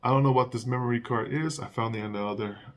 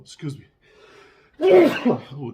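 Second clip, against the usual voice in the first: coughing fit from dusty storage; throat irritated, coughing; coughing on dust; 9 semitones higher, 13 dB louder, much slower than usual. Real, not acted. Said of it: "the dust is killing me".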